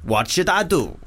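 a hammed-up panto Arabian accent reading a listener email; reading a listener's email in a silly Arabian accent. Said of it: "That was, uh, an Arabian accent".

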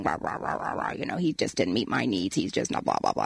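low, muttered mock grumble; grumbling. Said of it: "you know, grumbling".

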